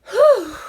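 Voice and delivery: loud exhausted exhale; breathless whew. Real, not acted. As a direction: blow out a big tired breath. Exhausted after fast talking.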